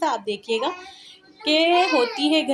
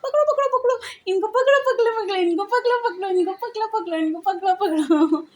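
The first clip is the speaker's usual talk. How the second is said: playful chase call; 7 semitones higher than usual. Real, not acted.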